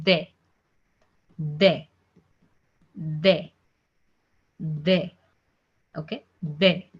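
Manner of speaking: "de" said the French way, short day; "de" with a French é, not dee; French letter D. Shown as a demonstration. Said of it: "the pronunciation of alphabet D in French".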